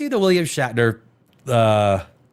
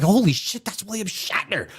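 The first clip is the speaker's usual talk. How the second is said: retold amazement, pitched up; starstruck astonishment; 6 semitones higher than usual. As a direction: replay the shock of recognizing a celebrity. Retells his starstruck reaction.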